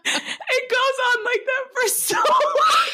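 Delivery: high, slow, laughing squeal; cracking up. Real, not acted. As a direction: barely get it out through real laughter. Cracking up after the scene.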